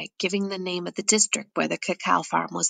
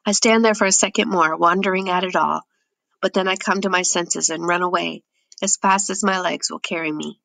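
ordinary delivery

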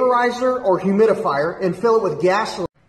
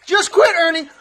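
high, loud drill-instructor harassment; taunting yell; 13 semitones higher, 5 dB louder than usual. Acted. Instructor urging drowning Ernie to quit, high taunting yell. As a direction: yell relentlessly to break his will.

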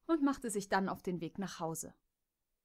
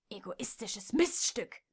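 angry insult. Acted. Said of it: "wieherte Kimberly wütend".

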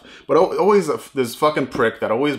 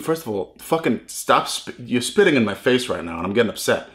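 usual speaking voice

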